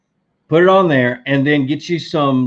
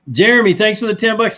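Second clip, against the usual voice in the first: excited thanks; 9 semitones higher, 4 dB louder than usual. Real, not acted. Greeting a donor.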